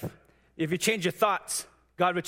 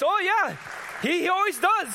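very high, louder playful agreement; mock woman's voice; 13 semitones higher, 3 dB louder than usual. Coworker's wife agreeing, very high playful voice. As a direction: chirp yes like a cheerful lady. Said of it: "Then she goes".

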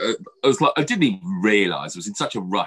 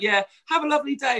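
put-on much higher child's chirpy echo; little boy voice. The son cheerfully echoing his mum. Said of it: "And he's like".

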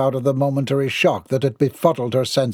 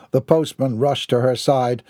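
usual speaking voice

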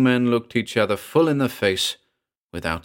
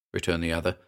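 ordinary delivery

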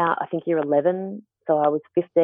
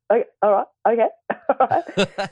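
stunned laughing okay; 7 semitones higher than usual. Retold or imitated. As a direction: let the bafflement melt into a giggle. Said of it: "I went".

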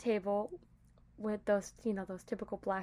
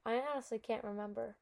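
ordinary talk

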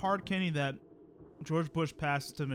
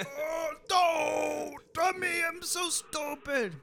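lightly voiced Charlie Brown, high wail; a hint of Charlie Brown's whine; 12 semitones higher, much slower than usual. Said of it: "Doing the Charlie Brown catchphrase".

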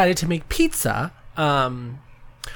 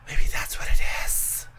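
gasped, whispered realization. Real, not acted. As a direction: breathe it out as a discovery. Gasp then sudden realization.

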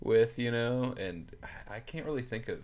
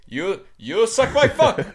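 high, loud mock Indian accent, laughing through it; hammed-up Indian English insult, cracking up; laughing imitation of an Indian English curse; 13 semitones higher, 13 dB louder than usual. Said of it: "videos of Indian dudes cursing each other".

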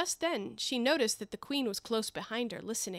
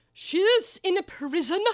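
much higher, much louder, slow and imperious; Queen's loud command; 9 semitones higher, 8 dB louder, slower than usual. Acted. The Queen telling the executioner about the Duchess, shouting. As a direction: boom it out slowly, a royal decree.

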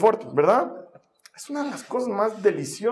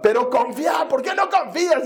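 exasperated insisting; 7 semitones higher than usual. Retold or imitated. El papá insiste a la hija.

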